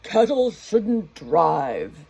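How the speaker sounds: very slow, loud grumbling mayor voice; angry mayor. Acted. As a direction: shake a fist and growl it out. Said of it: "The mayor shook his fist and said".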